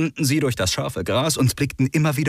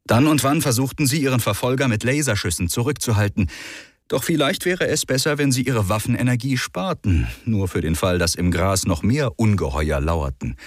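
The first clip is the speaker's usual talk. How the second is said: ordinary delivery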